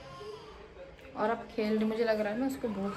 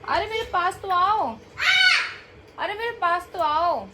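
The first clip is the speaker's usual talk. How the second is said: raised, higher coaxing call; 7 semitones higher, 5 dB louder than usual. Real, not acted. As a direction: call your little one to come to you. Mother calling her child over. (बच्चे को पुकारते हुए)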